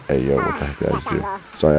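ordinary delivery

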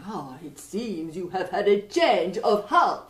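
put-on light French accent, playful stranger; a mischievous Frenchman teasing the king. French young man.

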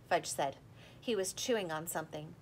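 usual speaking voice

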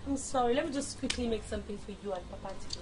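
smooth it over quietly; soft apology. Acted. Wife placates after her mistake.

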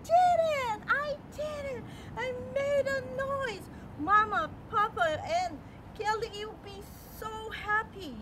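high, loud joyful cries of triumph; excited shout. Acted. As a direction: shout it out, thrilled she did it. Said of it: "Michaela was so excited".

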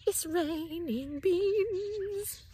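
very slow, high wondering voice; amazed and slow. Acted. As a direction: marvel at it slowly. Wonder at falling beans.

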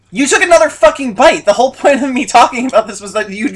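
loud exasperated outburst; angry. Real, not acted. Annoyed at friend eating again.

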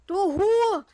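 loud high insult; angry shout. Real, not acted. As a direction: yell an insult. Shouts at killer.

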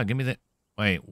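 usual speaking voice

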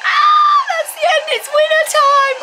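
high, loud thrilled cry; excited shout. Real, not acted. Fan shrieks as the winner reveal begins.

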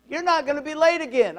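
retold fatherly telling-off; stern dad's scolding. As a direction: lay down the law, loudly. Retold scolding by girlfriend's dad.

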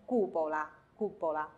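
cupola stressed on the first syllable, CU-po-la; early stress. Shown as a demonstration. Teacher teaching Italian dome word, cupola with stress moved forward to CU. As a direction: lean on CU in cupola, let -pola fall away. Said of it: "这个词重音要提前".

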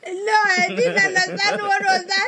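high, whining complaint; whiny protest. Real, not acted. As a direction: protest in a high wail, rising further on the repeat. Protesting words in an unknown language.

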